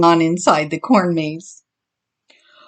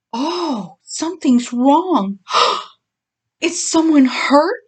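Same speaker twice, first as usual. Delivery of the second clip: high, slow worried asking; anxious; 7 semitones higher, slower than usual. Acted. Mama asking the dogs what happened, anxious. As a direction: fret aloud with a gasp. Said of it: "she asked anxiously".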